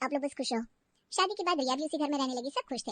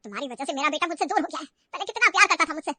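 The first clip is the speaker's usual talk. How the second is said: bitter reproach; 6 dB louder than usual. Acted. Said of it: "माँ ने कहा".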